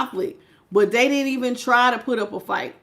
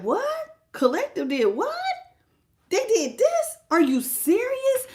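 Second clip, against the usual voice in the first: much higher, imitating someone stunned by gossip; an ex pretending to be floored, in a much higher voice; mock shocked disbelief; 11 semitones higher than usual. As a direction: play the ex hearing the news, half-hearted and incredulous. Acting the ex hearing the news.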